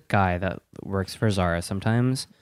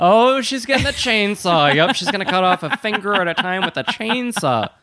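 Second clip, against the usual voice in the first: mock alarm; 10 semitones higher than usual. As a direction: play up the horror of the chainsaw.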